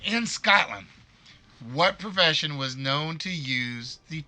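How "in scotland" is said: a hint of a Scottish accent, pitched up; half-hearted stab at a Scottish burr on "In Scotland"; lightly hammed Scottish accent; Scottish accent attempt. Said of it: "I can't do the accent".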